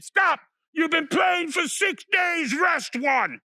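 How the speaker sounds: high, slow, booming command; voice from above. Acted. Voice from above orders the animals to rest, high slow shout. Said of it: "the voice from above".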